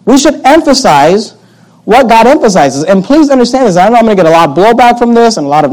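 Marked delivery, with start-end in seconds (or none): 0.0-2.8 slow emphasis. Real, not acted. Slow emphatic restatement of theme.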